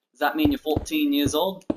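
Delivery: put-on accent, dropping the R in "fourteen". Streamer asking a viewer if they're fourteen, in a put-on accent. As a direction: ask it in a playful, non-rhotic put-on accent. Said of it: "I don't know why I didn't accent there".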